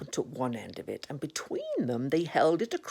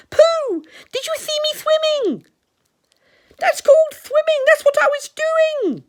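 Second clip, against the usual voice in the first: high, loud excited squeak; Roo; 12 dB louder than usual. Acted. Roo just out of the water, excited high squeak. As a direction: squeak it out, bursting with pride.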